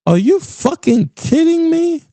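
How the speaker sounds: high, outraged disbelief; incredulous. Retold or imitated. Comedian reacting to a regretful caller, high incredulous outburst. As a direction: shriek the disbelief.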